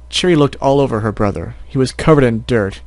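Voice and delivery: ordinary delivery, nothing marked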